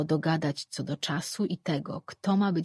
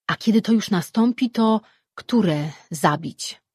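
louder, the last part slowed; a god in a heated quarrel, louder; quarrelling god; 4 semitones higher, 8 dB louder than usual. Acted. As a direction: argue heatedly, drawing out the end. Gods quarrelling.

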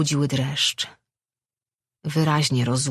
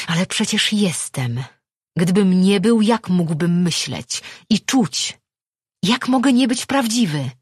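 raised, louder desperate protest; desperate, half-crazed; 5 semitones higher, 5 dB louder than usual. Acted. Man insisting that he is real, desperate. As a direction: plead loudly, half out of your mind. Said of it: "Wydawał się na poły szalony, na poły zdesperowany".

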